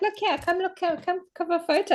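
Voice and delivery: high-pitched, eager beckoning; eager calling. Retold or imitated. Traveller calling her friend over for a photo, eager. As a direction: call a friend over for a picture.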